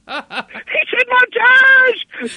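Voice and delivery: very high, drawn-out excited squeal; giddy disbelief. Retold or imitated. Amazed colleague reacting to Shatner's montage, giddy squeal.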